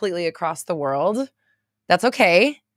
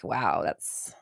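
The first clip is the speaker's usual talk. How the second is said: whispered aside; 12 semitones lower, 6 dB quieter than usual. Real, not acted. Aside about prejudice in old shows.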